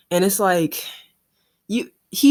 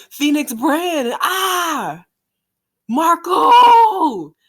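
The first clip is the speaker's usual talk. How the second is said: battle cry; 10 semitones higher, 7 dB louder, much slower than usual. Acted. Reading battle shouts.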